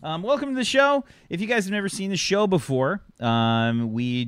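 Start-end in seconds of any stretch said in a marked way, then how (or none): 0.0-2.5 much higher, brighter host welcome; in character. Acted. Host reopens the show in a high put-on voice. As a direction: pitch the greeting way up as a hammed-up host. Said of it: "I'm just getting into character".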